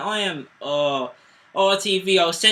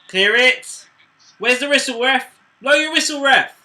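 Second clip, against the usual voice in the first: higher, louder pleas to the ref; desperate shouting; 6 semitones higher, 6 dB louder than usual. Real, not acted. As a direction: shout for the final whistle. Begging the ref to end it.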